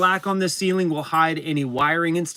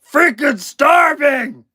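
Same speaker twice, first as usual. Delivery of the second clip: much higher, louder, slow put-on shout; dog's voice; 10 semitones higher, 8 dB louder, much slower than usual. Owner voicing his dog's hungry face, a slow loud shout. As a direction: voice the starving dog shouting. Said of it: "that face is like".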